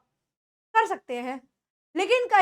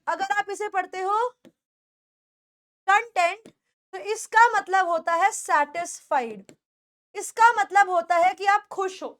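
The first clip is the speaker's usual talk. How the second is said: ordinary talk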